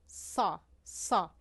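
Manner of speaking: thick, rounded ṣ in "سا"; heavy emphatic S. Shown as a demonstration. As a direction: darken the s of "سا" into Arabic ṣād. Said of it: "اما در حرف سا".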